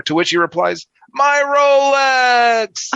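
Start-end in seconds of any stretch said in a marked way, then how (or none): 1.1-2.9 high, loud wail over the watch; anguished cry. Acted. Car owner grieving his watch in the punchline, mock anguished cry. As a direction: cry out like losing a treasure.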